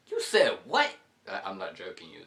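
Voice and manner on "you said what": high, loud incredulous mock outburst; imitating shocked rider. Stranger behind them reacting to the dad, mock high and loud. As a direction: demand it like you can't believe it.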